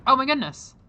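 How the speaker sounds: loud, high delighted gasp; excited exclaim. Real, not acted. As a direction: burst out in delight.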